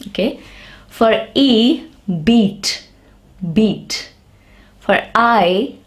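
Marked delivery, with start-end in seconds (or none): 2.3-4.1 "beat" with a long ee, like the letter name; long E sound. Shown as a demonstration. Teacher giving an example of long vowels, long E. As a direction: say "beat" twice, separately, with clear ee. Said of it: "In long sound, the letter is pronounced".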